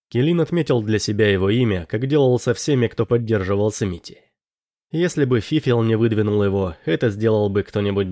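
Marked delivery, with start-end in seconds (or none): none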